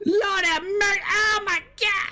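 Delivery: high, drawn-out wailing cries; mock screaming. Gamer testing when voice chat drops off, mock screaming.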